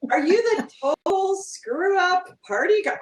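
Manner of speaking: high-pitched mock questioning; teasing retort. Author needling brother about the character, teasing and pointed. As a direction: toss it back teasingly, voice climbing at the end.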